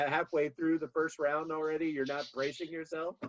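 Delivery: ordinary talk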